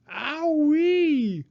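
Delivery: very high, loud yelp; crocodile cry of pain. A narrator's reading. Crocodile knocked over by the hippo, yelp of pain. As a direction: howl as he tumbles over. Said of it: "cried the crocodile".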